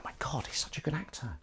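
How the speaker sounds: hushed whispered aside; voiceless mock-amazed murmur; awed whisper. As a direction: whisper it like an impressed onlooker. Casting panel's awed aside, acted.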